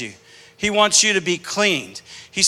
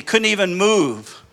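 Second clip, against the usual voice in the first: ordinary talk, nothing marked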